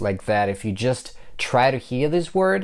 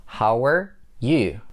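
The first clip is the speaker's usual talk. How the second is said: slow "How are", pause, then "you"; broken-down demo; slower than usual. Shown as a demonstration. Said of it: "In order to connect how and er".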